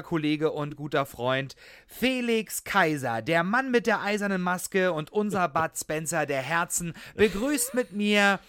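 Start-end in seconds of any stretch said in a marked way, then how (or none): 1.9-8.3 brash fight promoter hype. Acted. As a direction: build up to a booming name call. Host introduces co-host like a fighter.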